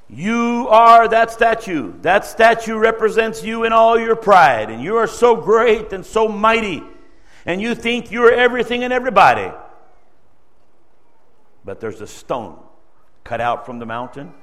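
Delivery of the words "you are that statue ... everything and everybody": higher and louder, driving home the pride; fiery preaching. Acted. Preacher confronting the proud king through his listeners, loud and fervent. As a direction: preach it at the king with full force.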